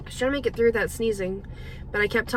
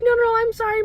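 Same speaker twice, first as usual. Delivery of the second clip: higher and louder, quick to reassure; eager apology; 12 semitones higher, 7 dB louder than usual. Retold or imitated. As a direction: wave off the blame brightly. Woman apologizing back.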